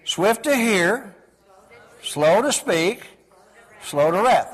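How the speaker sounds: each phrase drawn out slowly; slow, deliberate recitation. Shown as a demonstration. Preacher reciting scripture to the church, slow and deliberate. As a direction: weigh every word, slow. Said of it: "Therefore, my beloved brethren".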